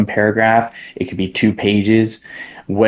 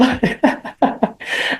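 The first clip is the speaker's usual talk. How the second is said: breathy laugh; 3 dB quieter than usual. Real, not acted. Laughing at own tearful story.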